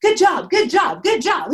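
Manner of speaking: loud, high cheer of good job; excited cheering. Retold or imitated. Founders hyping each other up in the elevator, loud excited cheer. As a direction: cheer them on like teammates after a win.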